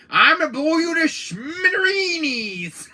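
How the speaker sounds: high, loud, slow laughing cartoon shout; hammed-up laughing shout at the enemy; Yosemite Sam shout. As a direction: bellow like an angry cartoon cowboy. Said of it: "Yosemite Sam, He says".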